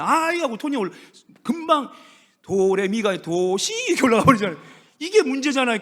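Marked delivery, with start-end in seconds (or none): 0.0-0.4 high, louder mock-irritated "아이"; rising irritated tone. Imitating a spouse's irritated outburst, higher and louder. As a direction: let "아이" jump up in annoyance. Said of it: "이러잖아요".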